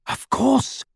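a hint of an excited cry; sudden cry. A narrator's reading. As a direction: blurt it out as it dawns. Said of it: "cried Hermione".

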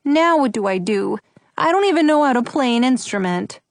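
put-on forlorn little boy; understated childish despair; miserable. A narrator's reading. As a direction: ask helplessly, as a mildly miserable child. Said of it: "Buddy said miserably".

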